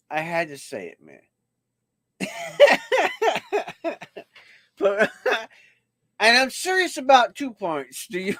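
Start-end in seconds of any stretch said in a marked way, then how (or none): 2.2-4.2 high laugh. Real, not acted. Host laughing at himself. 4.8-8.4 words broken by laughter; chuckling; laughing through words. Real, not acted. Laughing while trying to continue.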